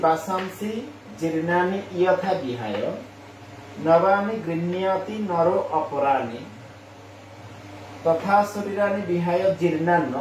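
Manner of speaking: slow syllable-by-syllable Sanskrit reading; slow clear recitation; careful recitation. Shown as a demonstration. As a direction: pronounce each word distinctly for learners. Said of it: "উচ্চারণটা এখানে আমি আবার বলছি".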